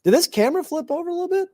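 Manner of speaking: quick high surprised question; startled, rising fast. Real, not acted. Surprised by camera flip.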